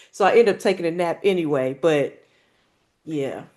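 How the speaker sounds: normal talking voice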